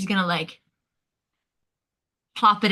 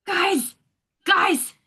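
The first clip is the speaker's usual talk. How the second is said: high, urgent call to the others; 9 semitones higher, 6 dB louder, slower than usual. Acted. Adventurer summoning friends to a find, calling out. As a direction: shout for friends' attention, pitched well up.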